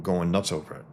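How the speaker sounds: ordinary talk, nothing marked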